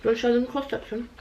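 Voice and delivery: talking through a mouthful; words round a bite of food; mouth full. Real, not acted. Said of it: "I'm talking with my mouth full".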